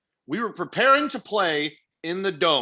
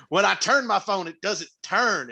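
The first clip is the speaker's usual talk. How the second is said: higher, drawn-out Southern dad; Southern accent; 5 semitones higher, slower than usual. Retold or imitated. Dad explaining his phone problem, Southern accent. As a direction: play Dad up with a slow Southern twang. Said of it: "giving my dad a Southern accent".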